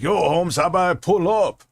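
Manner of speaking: hammed-up Brooklyn scammer, daring him; mock Brooklyn tough guy. Streamer imitating a Brooklyn scam caller, mock Brooklyn accent. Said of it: "he sounded like he was from Brooklyn".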